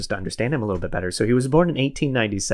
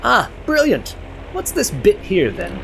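high, bright-eyed British gentleman voice; posh British officer; 10 semitones higher than usual. Acted. British lieutenant eagerly asking the midshipman, in a high British accent. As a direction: play the chipper British officer. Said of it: "a very British gentleman".

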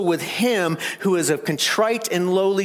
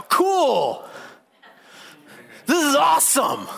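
mock careless reply; 9 semitones higher than usual. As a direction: play the flippant wrong answer, hammed up. Mock wrong reply of Isaiah.

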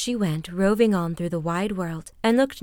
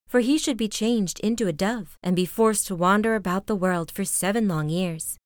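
ordinary delivery, nothing marked

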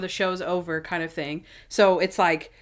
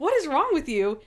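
high, indignant scolding voice, half-joking; mock indignant [name]; 7 semitones higher than usual. Assistant scolding her boss in a high, mock-indignant voice. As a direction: scold him in a raised, put-upon voice.

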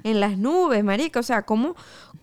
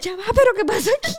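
high, fast and a bit louder, clueless; bewildered; 13 semitones higher, 4 dB louder, much faster than usual. Retold or imitated. Narrator mimicking herself in the bus brawl: high fast confusion. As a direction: play your younger self totally lost, pitch up and rush. She stands confused mid-fight.

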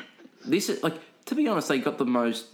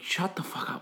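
higher, hushed retold outburst; infuriated; 7 semitones higher, 6 dB quieter than usual. Worker infuriated by the raffle lady, retold hushed fury. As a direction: recount a furious retort under the breath. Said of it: "I was so infuriated".

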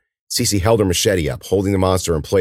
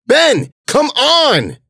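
very high, loud, drawn-out yell; desperate shout; 6 dB louder, much slower than usual. Acted. Cece pulling collapsed Ben's arm, frantic long shout. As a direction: stretch each word in a frantic yell. Friend fallen, monsters approaching.

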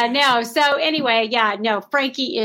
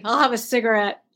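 ordinary talk, nothing marked

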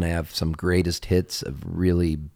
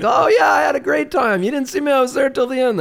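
high, loud, hammed-up cheerful lie; breezy fib; 6 dB louder than usual. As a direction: chirp it out like you never left early. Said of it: "You're like".

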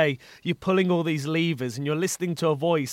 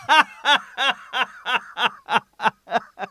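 hearty laugh; 7 semitones higher than usual. Real, not acted. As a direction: laugh freely at the joke. Laughing at the gig story.

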